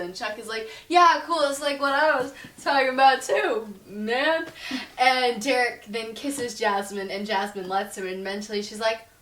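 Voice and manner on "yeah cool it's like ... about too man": higher, louder awkward bro voice; mock dude. Chuck brushing it off to Derek, a louder bro voice. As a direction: play the caught-out coach.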